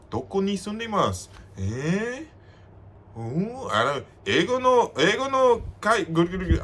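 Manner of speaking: lightly high, halting tipsy Japanese; imitating drunk locals. A drunk local fumbling for English words, lightly voiced. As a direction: stumble through mixed Japanese like a friendly drunk. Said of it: "they're gonna be like".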